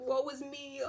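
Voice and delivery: mock lament; mock self-pitying victim. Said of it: "wants to walk around like".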